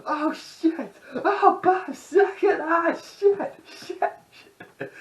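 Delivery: very high, laughing cursing yelps; high mock swearing fit trailing off; Tourette's guy outburst. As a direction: blurt the swears in a cartoon shriek. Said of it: "He's like".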